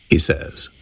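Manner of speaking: ordinary delivery, nothing marked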